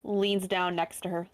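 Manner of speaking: ordinary delivery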